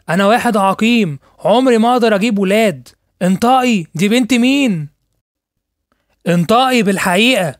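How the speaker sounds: betrayed husband shouting at the doctor; furious shouting. Acted. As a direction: shout at her for the truth. Husband finds the child isn't his.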